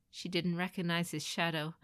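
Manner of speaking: plain reading voice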